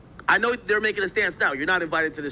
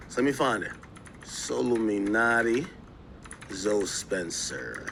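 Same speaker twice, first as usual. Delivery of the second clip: slow, drawn-out names, low; absent-minded search mumbling; 7 semitones lower, 3 dB quieter, slower than usual. Real, not acted. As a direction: stretch each word out as if typing it in. Typing names while searching.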